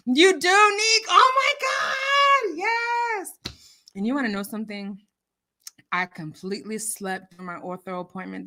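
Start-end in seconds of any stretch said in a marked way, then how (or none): 0.0-3.3 high, loud, slow squeal of joy; overjoyed. Real, not acted. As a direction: shout with delight. Overjoyed reaction to compliment.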